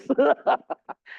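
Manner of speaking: short nervous laugh. Real, not acted. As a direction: laugh off the nerves. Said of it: "I'm nervous".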